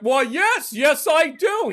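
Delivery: high, loud, chirpy acceptance; naive eager newcomer. Acted. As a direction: play the gullible out-of-towner. Playing his naive, eager younger self.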